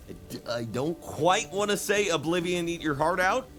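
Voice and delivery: usual speaking voice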